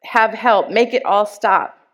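loud, desperate plea for help; desperate begging. Retold or imitated. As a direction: call out to God, begging. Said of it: "I begged".

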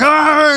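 high, loud charging yell; mock-heroic war cry; battle yell. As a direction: yell as you charge into a fight. Parent charging in to fight.

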